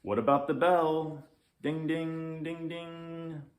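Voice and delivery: high sing-song ding ding; bell sound. Acted. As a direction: chime it like a little bell. Child rings a toy bell.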